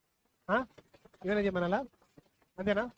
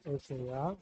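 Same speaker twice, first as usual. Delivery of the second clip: put-on slow, softer prayer line for the child to repeat; leading a prayer; 7 semitones lower, 5 dB quieter, much slower than usual. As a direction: pray it slowly so the child can follow. Adult leads prayer. (నెమ్మదిగా, మెత్తగా ప్రార్థన)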